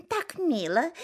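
high, sweet mock admiration; cooing turkey hens. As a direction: gush over it like a silly turkey hen. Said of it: "шейками покивали и сказали". (умилённые индюшки)

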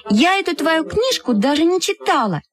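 high-pitched schoolgirl outrage; indignant squabbling. Acted. Two friends quarrel over a book, indignant. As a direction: argue hotly, keeping the pitch right up.

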